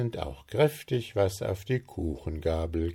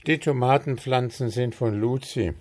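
annoyed hiss; 6 semitones higher, 4 dB louder, faster than usual. Acted. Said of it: "zischte Puh verärgert".

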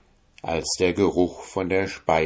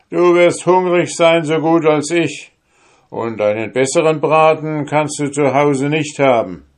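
hearty soldier coaxing; 8 semitones higher, 6 dB louder than usual. Acted. Soldier urges hunter to eat.

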